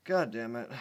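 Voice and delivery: ordinary delivery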